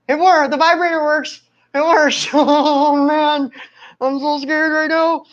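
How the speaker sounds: high, jittery nervous voice; high-pitched nervous babble before the shock; nervous excitement. Real, not acted. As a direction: voice jumps up with nerves.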